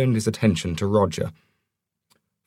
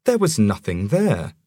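ordinary delivery

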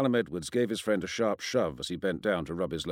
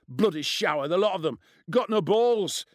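high, loud contempt; roaring scorn; 8 semitones higher, 4 dB louder than usual. A narrator's reading. Bully writes off the teachers, roaring with scorn. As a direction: dismiss the lot of them, building louder. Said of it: "Ian roared".

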